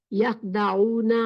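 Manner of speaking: each syllable drawn out slowly; slow, careful Quranic reading; slow recitation. Shown as a demonstration. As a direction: read the Arabic word carefully and slowly, like a teacher. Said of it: "teacher reads Quranic word".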